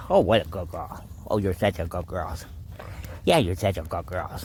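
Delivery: dote on her gently; fond praise. Real, not acted. Owner cooing over the dog as she lies down, tender.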